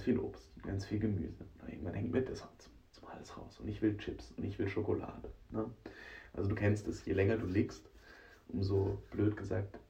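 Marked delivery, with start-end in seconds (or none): none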